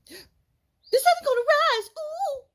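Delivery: high, slow squeaky squirrel voice; high piping squirrel with a cooing ooh. Acted. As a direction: pipe it up like a tiny squirrel. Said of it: "little brown squirrel piped up".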